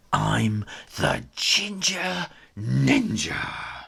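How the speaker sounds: very slow, weighty; proud kitten reveals himself; slow heroic claim. Acted. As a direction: announce it like a legend. Hero declares.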